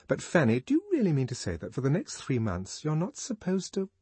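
lightly voiced young woman; Lenina's voice.

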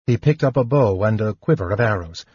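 ordinary delivery

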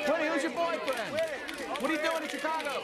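high, urgent shouted questions; paparazzi calling out. Acted. Photographers hound Winnie outside, shouting questions. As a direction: yell to get the star's attention.

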